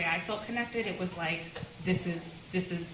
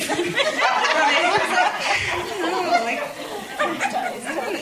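laughing; 12 semitones higher, 8 dB louder, faster than usual. Retold or imitated. Husband wounded, told through laughter.

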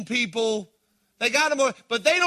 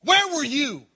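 Mary's raised, accusing cry; angry; 5 semitones higher, 4 dB louder than usual. Acted. Grieving sister confronting Jesus, angry. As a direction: demand it of Jesus, hurt and angry. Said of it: "in anger".

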